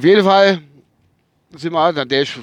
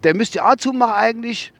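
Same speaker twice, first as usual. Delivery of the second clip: higher quoted driver; driver's voice; 6 semitones higher than usual. Retold or imitated. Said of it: "hat er gesagt".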